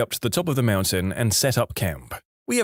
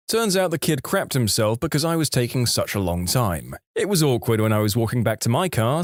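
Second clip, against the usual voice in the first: usual speaking voice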